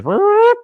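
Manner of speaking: high, loud imitated bullhorn blip; short loud siren-like whoop. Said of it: "and a bullhorn goes".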